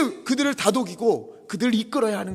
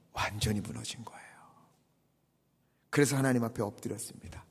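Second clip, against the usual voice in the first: usual speaking voice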